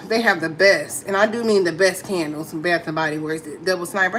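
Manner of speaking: ordinary talk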